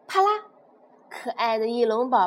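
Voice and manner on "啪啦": ordinary delivery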